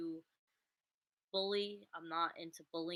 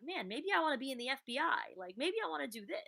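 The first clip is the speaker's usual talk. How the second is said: excited musing; 8 semitones higher than usual. Retold or imitated. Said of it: "now I'm thinking about, like".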